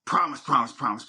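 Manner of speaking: ordinary delivery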